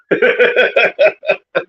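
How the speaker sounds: a short laugh at his own joke, falling away; sheepish laugh. Real, not acted. Commentator laughing at his own teary joke to viewers, sheepish laugh. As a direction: laugh it off, embarrassed at being moved.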